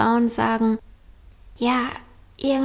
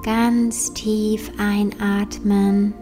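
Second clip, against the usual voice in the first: slow, soft meditation voice; guided meditation; 5 dB quieter, slower than usual. Acted. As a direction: guide them gently into calm, slowly.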